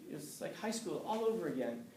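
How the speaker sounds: normal talking voice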